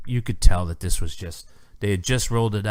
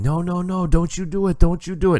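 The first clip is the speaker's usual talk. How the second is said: put-on "No, no, no" in a higher, rising Italian-accented nenek scolding, louder at the end; mock Italian grandmother; 10 semitones higher, 4 dB louder than usual. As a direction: imitate an Italian nonna wagging her finger, heavy Italian accent, voice climbing. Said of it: "he had that thick accent".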